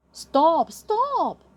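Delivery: high, loud alarmed cry; panicked shouting waiter; crying stop. Acted. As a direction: shout to stop him eating the menu. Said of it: "cried the waiter".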